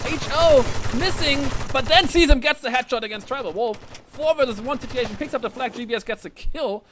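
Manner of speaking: high-pitched esports call; excited play-by-play. Real, not acted. Caster calling the round's deciding kill, excited play-by-play. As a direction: raise the pitch and carry the action through.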